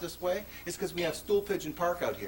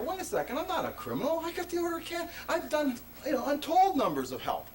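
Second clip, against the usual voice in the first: higher, indignant self-talk; indignant inner thought; 10 semitones higher than usual. Retold or imitated. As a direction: protest to yourself, voice up high. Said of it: "I got stinking thinking in there".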